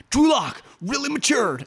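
high, slow mock fan gushing; mocking hype. Fan imitating hype from his timeline, high mocking gush.

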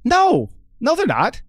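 high, sharp "No!"; flat rejection, pitch jumps up; emphatic no. Real, not acted. Emphatic rebuttal.

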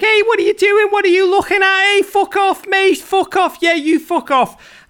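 loud high Scouse taunt, shouted across the schoolyard. Retold or imitated. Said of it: "I'd be like".

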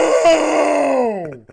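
high, loud, drawn-out cry of no; retold scream. Daughter heard from the back of the store, screaming. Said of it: "She screams".

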